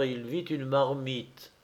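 ordinary delivery

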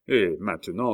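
plain reading voice

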